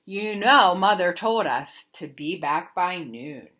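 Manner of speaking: plain reading voice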